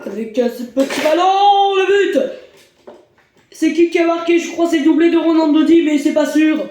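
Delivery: high, loud goal call; excited sports commentary. Acted. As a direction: call the goal live, fast.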